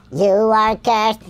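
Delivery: ordinary talk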